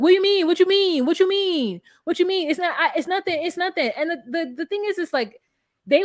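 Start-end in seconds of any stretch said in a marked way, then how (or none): 0.0-3.9 flustered denial. Retold or imitated. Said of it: "he has to just be like".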